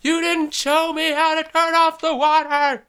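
imitated yelling back. Wife yelling back at husband during the leak, shrieked imitation. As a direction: push the impression up to a strained holler. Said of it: "she's yelling back at me".

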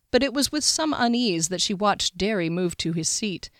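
ordinary delivery

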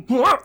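big, loud mock retch; imitated gag. Co-host acting out Bella starting to gag, mock retch. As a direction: heave out a high gagging noise. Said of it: "starts to gag and goes".

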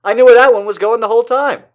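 crowing over a poem well read; triumphant brag. Retold or imitated. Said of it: "all I can say is".

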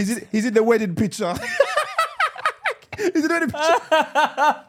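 laughing through the words, high and loud; can barely get it out for laughing. Real, not acted. Laughing at cousin's wedding photo.

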